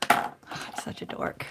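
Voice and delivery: whispered, self-mocking aside. Real, not acted. Crafter laughing at herself, whispered aside. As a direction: breathe it out at herself.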